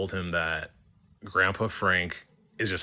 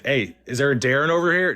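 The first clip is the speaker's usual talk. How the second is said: raised, louder voice asking round; retold call-out, pitched up; 5 semitones higher, 6 dB louder than usual. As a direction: call across to a group of strangers. Calls out to find Darren.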